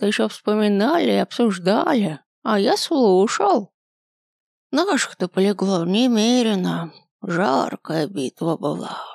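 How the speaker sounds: high, creaky, slow ancient grandpa; very old man. A narrator's reading. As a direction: groan it out like a frail old man.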